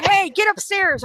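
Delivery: loud, high, drawn-out neighbor's yell; imitated yelling. Neighbor calling from her window to the teen, yelling. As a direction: holler slowly up at someone, imitating a neighbor. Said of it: "my neighbors start yelling".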